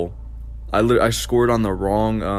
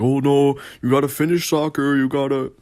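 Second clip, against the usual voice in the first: lightly mocking parent, pitched up; insisting parent; 5 semitones higher than usual. Parents telling crying kid to keep at soccer, mock insistent. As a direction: imitate a parent nagging, higher than usual.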